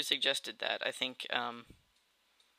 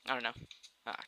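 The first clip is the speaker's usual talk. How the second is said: usual speaking voice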